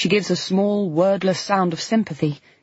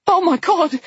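high, shocked, breaking; near tears; 9 semitones higher than usual. A narrator's reading. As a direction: cry out in horror. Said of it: "She was almost crying".

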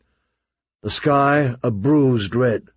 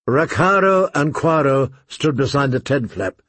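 plain reading voice

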